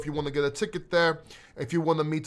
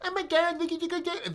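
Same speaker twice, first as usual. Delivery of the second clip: high, whiny mock commenter; 10 semitones higher than usual. Streamer mimics angry commenters in a whiny voice. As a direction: whine it like an outraged commenter mocking you. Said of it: "this is how they talk".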